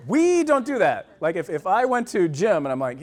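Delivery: usual speaking voice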